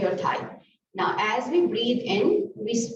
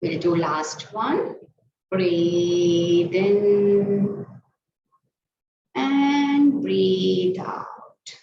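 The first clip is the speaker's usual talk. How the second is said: long, stretched breath cues; drawn-out breathing cues; much slower than usual. Shown as a demonstration. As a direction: guide the class breath by breath. Yoga teacher cueing breaths.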